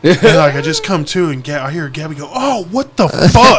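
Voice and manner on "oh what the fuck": high, long drawn-out yelp of outrage; startled outcry. Retold or imitated. Partner punched in her sleep, shocked outcry. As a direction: imitate someone jolted awake, stretching the swear.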